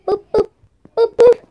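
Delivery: high, loud, bouncy boops; playful boops. Real, not acted. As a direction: boop it like tapping a button nose. Tapping the panda's tail.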